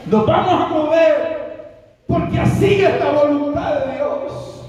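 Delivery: father's answer turned into a fervent sermon; preaching climax. Acted. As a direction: proclaim God's will as a sermon peak. Father's words preached at full force.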